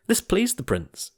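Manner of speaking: usual speaking voice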